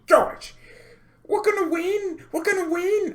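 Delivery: squeaky put-on Manchester accent to mock rival fans; hammed-up Mancunian football fan chant. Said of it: "I don't even know what a Mancunian accent sounds like".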